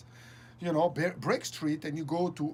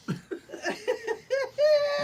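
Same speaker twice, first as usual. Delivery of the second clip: high-pitched burst of laughter; 5 dB louder than usual. Real, not acted. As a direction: crack up at the memory. Laughing at memory.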